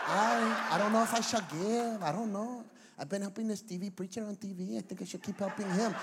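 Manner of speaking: put-on unsure churchgoer in Mexican accent. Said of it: "my little Mexican accent".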